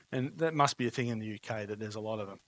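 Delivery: normal talking voice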